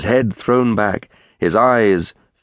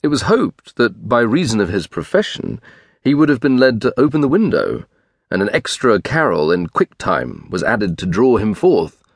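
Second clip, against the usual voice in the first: usual speaking voice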